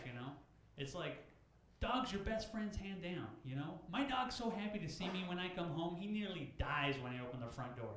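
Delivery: ordinary talk, nothing marked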